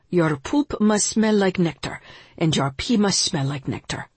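put-on a teacher's teasing Indian accent; Yogi Bhajan, Indian accent. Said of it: "with a twinkle in his eye and a strong Indian accent".

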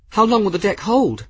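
high, loud, anxious demand; alarmed question. A narrator's reading. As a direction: ask urgently as danger mounts. Deck burning, alarmed question.

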